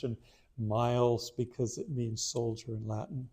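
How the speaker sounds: ordinary talk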